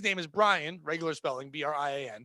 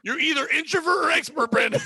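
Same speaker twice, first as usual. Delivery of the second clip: higher and louder, calling out the name; playful shout; 8 semitones higher, 4 dB louder than usual. Acted. Host teasing friend Brandon, playful shout. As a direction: yell it at him for a laugh.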